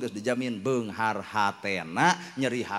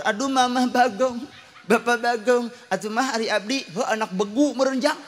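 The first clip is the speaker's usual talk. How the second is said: put-on high and louder, whining like a child; child's voice; 10 semitones higher, 6 dB louder than usual. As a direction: act out the kid's pleading cry. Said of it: "Teu nyaho anak jadi naur". (budak ngarenghik, sora luhur tur tarik)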